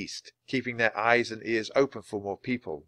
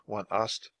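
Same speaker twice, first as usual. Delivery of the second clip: ordinary delivery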